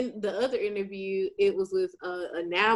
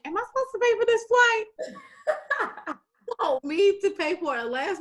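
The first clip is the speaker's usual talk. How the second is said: laughing disbelief; 13 semitones higher than usual. Retold or imitated. Asking the recruiter about the flight, high and laughing. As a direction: laugh through the question in disbelief. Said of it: "So I'm like".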